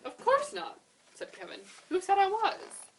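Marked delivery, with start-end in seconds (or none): 0.0-0.8 Kevin's voice. A narrator's reading. Kevin's squeaky reply. 1.9-2.9 Kevin's voice. A narrator's reading. Kevin puzzled.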